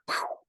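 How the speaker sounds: sudden airy rifle-shot noise; imitated gunshot. Storyteller recreating the shot out the window, imitated gunshot. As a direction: mimic a rifle going off.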